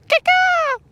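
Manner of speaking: very high squawking bird cry; mock eagle screech. Mocking the lure as an eagle, very high squawk. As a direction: screech like a weak little eagle.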